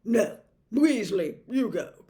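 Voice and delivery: curt bark at Percy; barked order. A narrator's reading. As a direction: order the assistant off. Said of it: "Fudge barked at Percy".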